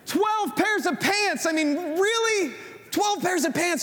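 high, slow, drawn-out disbelief at twelve pairs of pants; exasperated disbelief. Retold or imitated. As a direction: stretch it out like you can't believe it. Husband exasperated at shopping.

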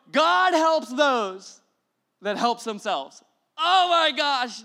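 very slow, high, loud mock-solemn quote; mock reverent drawl. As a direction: stretch it out like a proud platitude. Quote drawn out in mock awe.

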